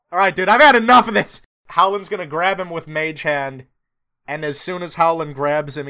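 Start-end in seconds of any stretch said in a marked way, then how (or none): 0.1-1.4 loud, fast exasperated outburst; fed up. Acted. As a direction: snap that you've had enough, loud.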